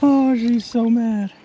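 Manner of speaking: high, slow, delighted exclaim; excited. Real, not acted. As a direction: savor the thrill, drawn out high. Fish fighting.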